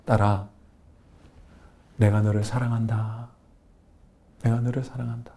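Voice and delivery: low, soft and tender voice of God; God's gentle voice. Retold or imitated. God consoling the worn-out deaconess, low and tender. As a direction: speak softly to a hurting daughter. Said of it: "하나님의 음성이 들립니다".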